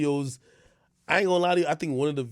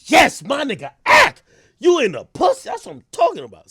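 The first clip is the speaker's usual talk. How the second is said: mock fan cheer, much higher and louder; hyped cheer; 12 semitones higher, 11 dB louder than usual. Imitating his audience cheering him on, a hyped shout. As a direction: whoop it up like a crowd of fans. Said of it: "they'll be like".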